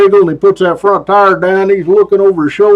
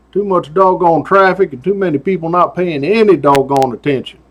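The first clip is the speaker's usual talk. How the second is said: ordinary talk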